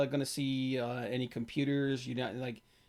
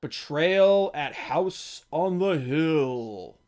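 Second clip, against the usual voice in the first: slow, louder title announcement; dramatic announcer; 6 dB louder, much slower than usual. Acted. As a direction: announce it grandly, slowly. Announcing the next game.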